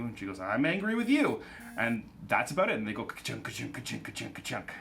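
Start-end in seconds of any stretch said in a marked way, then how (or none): 0.4-1.4 high-pitched hammed-up retort; mock angry queen. 3.0-4.7 soft, low rhythmic ka-chunks; imitated clanking exit.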